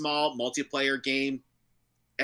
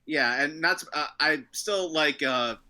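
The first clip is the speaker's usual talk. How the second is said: ordinary delivery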